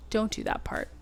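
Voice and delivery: a soft unvoiced whisper; whispered aside. Real, not acted. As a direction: tell them off under your breath. Quick aside to viewers.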